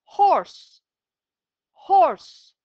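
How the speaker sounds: Horse called out high and loud, twice; teacher booming Horse; loud model word. Shown as a demonstration. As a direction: announce Horse clearly for the class to echo. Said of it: "Arkamdan telaffuz ederseniz".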